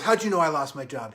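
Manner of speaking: loud, indignant question; suspicious drunk guy. Retold or imitated. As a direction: demand to know, loudly. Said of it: "The guy goes".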